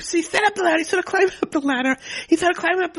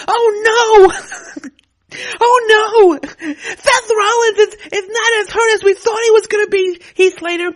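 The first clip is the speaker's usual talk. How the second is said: higher and louder, hammed-up alarm; mock panic; 4 semitones higher, 6 dB louder, slower than usual. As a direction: cry out like the plan fell apart. Corbin panics, Rollins recovered.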